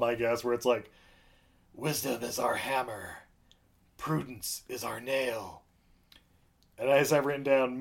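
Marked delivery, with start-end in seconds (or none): 1.7-5.6 slow, soft solemn recitation; recited poem. Retold or imitated. Dying king reciting his poem to Farmer, slow and solemn. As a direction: intone it like sacred verse. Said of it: "this poem".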